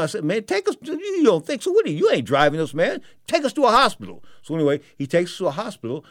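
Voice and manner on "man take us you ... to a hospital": high-pitched, incredulous pushback; indignant protest. Retold or imitated. Passenger insisting the reluctant driver go to a hospital, indignant. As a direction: argue back at him in a raised pitch.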